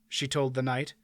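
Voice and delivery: ordinary delivery, nothing marked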